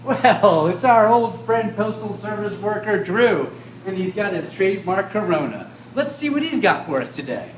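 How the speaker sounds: loud, high guest announcement; excited introduction. Acted. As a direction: introduce the guest like a game show.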